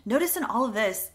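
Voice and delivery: ordinary talk, nothing marked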